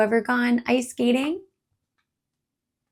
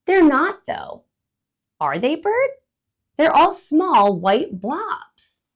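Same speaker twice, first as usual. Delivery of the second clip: louder, higher skeptical squirrel; doubtful squirrel; 7 semitones higher, 8 dB louder, slower than usual. Acted. Skeptical squirrel. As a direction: squirrel disputes bird about snowflakes.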